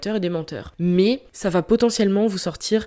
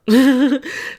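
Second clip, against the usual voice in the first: short laugh; 6 semitones higher, 6 dB louder than usual. Real, not acted. Laughs after admitting a mistake.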